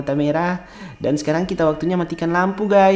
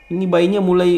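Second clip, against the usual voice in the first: ordinary talk, nothing marked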